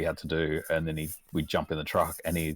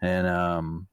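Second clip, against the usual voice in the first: usual speaking voice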